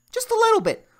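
squeaky high voice. Pretend. Squeaky repeat.

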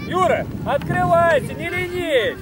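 loud, slow urging shout; yelling from the sidelines. Real, not acted. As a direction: push the lazy player from the touchline. Coach urging lazy player. (крик тренера с бровки)

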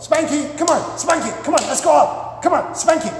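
gee the dog up in a high excited voice; acted giddy owner calling a pet; over-excited dog owner. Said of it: "this is what you do every time you wanna go out".

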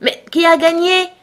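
high, loud, mildly breathless question; excited crowd, a touch out of breath; panting. A narrator's reading. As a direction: ask urgently, as if just stopped running. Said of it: "en demandant d'une voix haletante".

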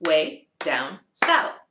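very slow, each word apart; one clap per word. Shown as a demonstration. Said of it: "only one clap".

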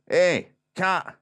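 put-on loud, high Scottish accent; indignant Scottish bandsman calling out; Scottish bloke. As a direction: warn forcefully in a broad Scottish voice. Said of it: "one of the Scottish blokes in the Scottish band".

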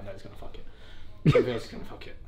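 low, very quiet repeated aside; resigned muttering. Real, not acted. Man dreading his friend's next gift, muttering to himself.

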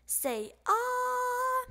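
high put-on doctor voice; doctor's voice. A narrator's reading. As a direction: coax the patient to open up. Doctor asks to open mouth.

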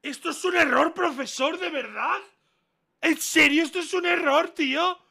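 high-pitched, loud disbelief; outraged shouting. Real, not acted. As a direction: protest at the top of your voice. Outraged at being marked wrong.

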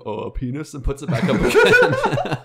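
high, loud laughing burst. Real, not acted. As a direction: crack up mid-sentence. Retold intercom joke, laughing.

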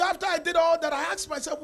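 ordinary talk

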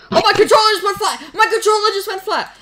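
high, loud mocking whine with a laugh in it; mocking imitation. Mocking his brother's controller excuse, high whiny imitation.

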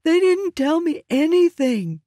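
high, loud, desperate cry; cowering terror. A narrator's reading. Prisoner with a knife at his neck, high desperate cry. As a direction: plead for your life at a knifepoint.

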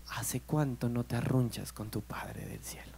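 soft, slow whispered question; preacher whispers to the congregation about their heavenly Father; intimate whisper. Real, not acted. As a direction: lean in and whisper it to each listener.

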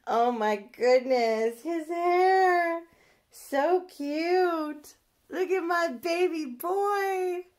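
put-on high, loud sing-song baby coo; retold gushing. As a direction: replay the moment she squealed over him. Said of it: "That's how I was like".

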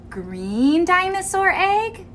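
ordinary delivery

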